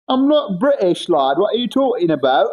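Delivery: mock British accent answering a chat comment. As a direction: tease back in a put-on British accent. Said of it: "British man with a mental lag".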